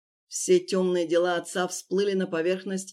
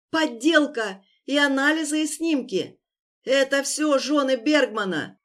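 tearful shouting; 6 semitones higher, 4 dB louder than usual. Acted. Wounded victim blurts out.

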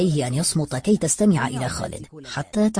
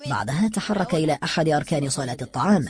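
plain reading voice

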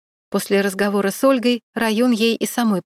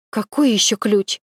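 mildly puzzled question; surprised. A narrator's reading. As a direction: ask back in slight surprise. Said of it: "переспросила Марина удивлённо". (удивлённо переспрашивая)

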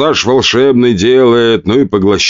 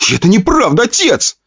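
high, pleading cry of protest; shouted denial. Acted. Son shouts his innocence at his furious father, high cry. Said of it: "выкрикнул парень".

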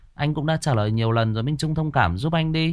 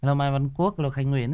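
ordinary talk, nothing marked

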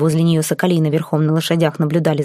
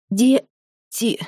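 hoarse through coughing; 6 semitones higher than usual. A narrator's reading. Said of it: "сквозь кашель прохрипела я".

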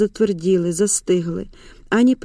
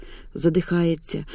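plain reading voice

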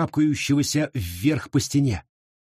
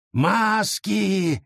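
high ringing cry; shout; 6 semitones higher than usual. Acted. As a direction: call out the warning sharply. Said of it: "крикнул кто-то поблизости".

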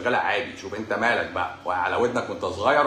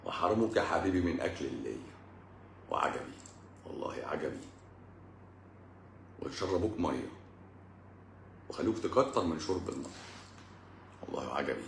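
low, hushed wry refrain; quiet, low recited lament; mock lament; 8 semitones lower, 12 dB quieter than usual. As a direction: drop right down, as if musing sadly.

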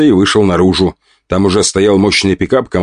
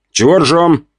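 guy voice; 7 semitones higher, slower than usual. Acted. Костя enters, voiced figure.

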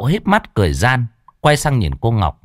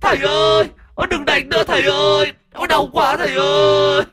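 much higher, wailing little ghost voice; shrieking ghost in pain. A narrator's reading. Ghost being whipped by demon guards, shrieking in pain. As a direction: cry out as the whips land. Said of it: "đau đớn rú lên".

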